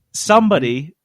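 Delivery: normal talking voice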